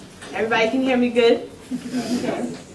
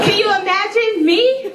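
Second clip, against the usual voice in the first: laughing question, pitched up; 7 semitones higher than usual. Real, not acted. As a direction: laugh through the words at herself. Joking about being too loud.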